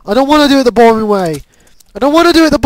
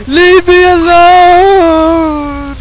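higher, very slow cry; drawn-out wail; 4 semitones higher, much slower than usual. Real, not acted. Gamer begging the dog to leave, drawn-out wail. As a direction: stretch the last word into a long wail.

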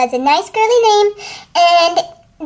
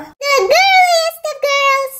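gushing; 7 semitones higher, slower than usual. Acted. Gushing over the makeover.

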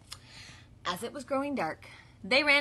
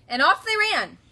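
louder, higher storybook flourish; excited narration; 8 semitones higher, 11 dB louder than usual. A narrator's reading. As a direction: send them racing off. Narrator speeds the flock away.

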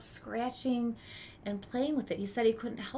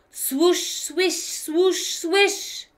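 higher, rising swoosh swish; swishing skirt sounds; 8 semitones higher, 11 dB louder, much slower than usual. Acted. Boy mimicking ladies' skirts hurrying past, rising swishes. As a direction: sweep the sounds up like skirts rustling.

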